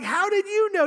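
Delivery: high, loud panicked-style yell; screaming. Retold or imitated. Rider on the swing yells at nephew who released them, screaming. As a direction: re-enact screaming your head off in mid-air. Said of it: "I'm screaming my head off".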